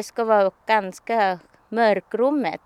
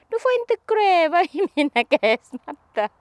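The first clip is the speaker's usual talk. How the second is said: scolding; 9 semitones higher, 5 dB louder than usual. Retold or imitated. Telling Peter off in story.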